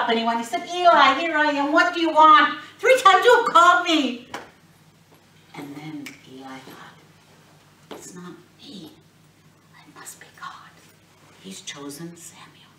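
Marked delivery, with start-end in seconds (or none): 0.7-4.3 put-on high, loud exasperated boy; the boy protesting he was called three times; much higher and louder, exasperated. Boy frustrated third time. 7.9-12.7 softer, whispered realization; whispered thought. Acted. Eli realizing privately.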